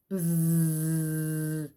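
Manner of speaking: low, soft buzzing; bee buzz. Shown as a demonstration. Reading animal sounds book.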